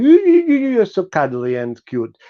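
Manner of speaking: put-on high cooing baby-talk voice; sweet baby talk to a pet. Shown as a demonstration. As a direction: coo it like talking to a baby. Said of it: "That's what I say to you, oh baby".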